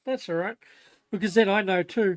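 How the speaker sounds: loud, lifted voice to someone off mic; calling out. Real, not acted. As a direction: project it across the house.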